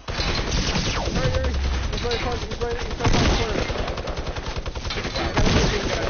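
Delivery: loud frantic callouts; alarm shout. Real, not acted. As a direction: shout frantically mid-fight. Frantic callouts during firefight.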